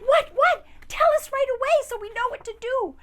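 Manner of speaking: high, loud and fast; frantic robber gang; urgent robbers. Acted. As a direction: demand it in a rush. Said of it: "and they said".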